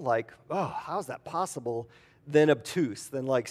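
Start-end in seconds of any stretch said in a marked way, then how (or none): 0.5-1.9 soft, wondering sigh of a question with a little laugh; quietly wishful, half-laughing disbelief; wistful. Retold or imitated. Nicodemus musing to Jesus, soft and wistful. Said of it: "like, wistful".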